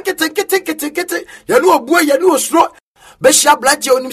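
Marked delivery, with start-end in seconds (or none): 0.0-1.2 fading repetition. Real, not acted.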